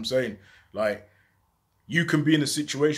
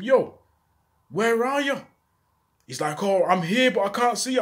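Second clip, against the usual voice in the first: urgent call; 8 semitones higher, 3 dB louder than usual. Retold or imitated. Frantic phone call while bleeding.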